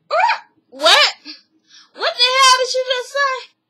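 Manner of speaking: imitating a stunned wife who can't believe her ears; shocked disbelief. Wife reacting to husband's confession, shocked and high.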